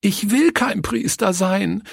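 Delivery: put-on high, tearful little-girl protest; sobbing cry. A narrator's reading. Leni refusing to play the priest, calling out sobbing. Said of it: "rief sie schluchzend".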